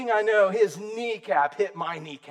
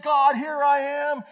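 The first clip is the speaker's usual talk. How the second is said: shouting; 6 semitones higher, 3 dB louder, slower than usual. Retold or imitated. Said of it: "when Tony said".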